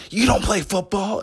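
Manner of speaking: high, loud mock disbelief; incredulous girl. Girl challenging the stranger at a party, loudly incredulous. Retold girl's disbelief, rising.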